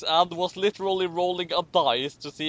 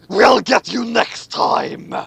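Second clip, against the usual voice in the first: louder, slow villain's vow; villain's parting threat; 4 semitones higher, 9 dB louder, slower than usual. A narrator's reading. Emperor vows revenge as he leaves, villain's threat. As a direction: drawl out the villain's promise. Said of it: "said the emperor".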